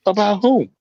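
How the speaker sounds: high taunting put-on voice; mock a squeaky bully. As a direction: sneer it in a silly high voice. Mocking imaginary offended people.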